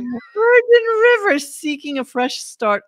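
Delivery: loud, high, slow trailer-style read; dramatic synopsis read. Acted. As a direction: announce the show with mock grandeur. Mockingly reads out show's synopsis.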